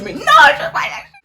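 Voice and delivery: high, loud, laughing defiance; laughing refusal. Retold or imitated. Girl laughing as she refuses to let go of him. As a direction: refuse with a giggle. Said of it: "moi, je suis".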